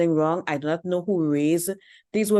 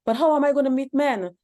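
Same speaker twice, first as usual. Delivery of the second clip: pitched-up mock voice of a confused young woman; mocking whiny woman; 8 semitones higher, 3 dB louder than usual. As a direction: mimic her helpless question, higher. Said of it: "And you're gonna be like".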